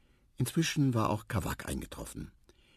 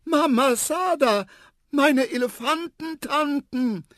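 put-on very high, loud, drawn-out elephant child's shout; joyful trumpeting cry; 6 dB louder than usual. A narrator's reading. Little elephant spotting his aunts, joyful high trumpeting. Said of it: "einen freudigen Trompetenschrei".

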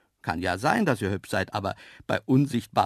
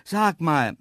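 raised, louder, impatient; growing impatience; 6 semitones higher, 6 dB louder than usual. A narrator's reading. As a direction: start to lose patience. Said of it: "ich wurde langsam ungeduldig". (langsam ungeduldig)

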